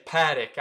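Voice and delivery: Patek as PAD-ick; first-syllable stress on Patek; mispronunciation. Retold or imitated. Teacher quotes people who get it wrong, mispronunciation. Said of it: "The people that say".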